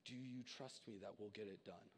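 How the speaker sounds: God offering help, hushed and tender; gentle voice of God. Pretend. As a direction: speak as God, soft and reassuring. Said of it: "And Jesus is going".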